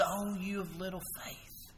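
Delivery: soft, hushed, gentle rebuke. Retold or imitated. Jesus lifting Peter up, soft gentle rebuke. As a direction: speak it softly, like a patient teacher. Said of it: "he says".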